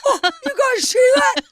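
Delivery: high, urgent mock excitement; excited owl-spotter. Man trying to get them to look at an owl, high and excited. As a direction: get their attention in a high, worked-up voice. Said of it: "He looks like he's like".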